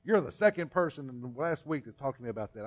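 loud, fed-up groan of a man pestered again; exasperated complaint. Acted. Gary, irritated, complaining to the preacher. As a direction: push back, annoyed at being asked twice. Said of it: "And Gary says".

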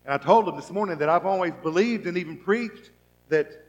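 usual speaking voice

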